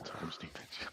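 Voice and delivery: breath-only whisper, very soft; hushed, voiceless teasing; whispered joke. Pretend. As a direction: whisper it as a sly aside.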